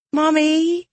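put-on very high, drawn-out child's call; young girl. A narrator's reading. Girl coming downstairs rubbing her eyes, high child voice. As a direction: call out as a sleepy little daughter. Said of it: "a girl said".

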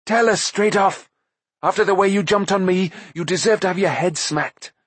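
high, sharp angry demand; impatient. A narrator's reading. Taran scolds Gurgi angrily, high. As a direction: snap the threat at him. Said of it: "could no longer control his impatience".

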